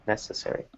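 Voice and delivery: "Necessary" very soft, stressed on NEC; stress on first syllable. Shown as a demonstration. Teacher checking the stress, "Necessary" softly stressed on NEC. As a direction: quietly stress the start of "Necessary". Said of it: "it would be on the first syllable".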